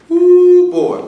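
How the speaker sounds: a sudden high, loud ooh of disbelief; shocked ooh. Real, not acted. Preacher gasps at the boy's disrespect, a loud shocked ooh. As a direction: let it burst out, appalled.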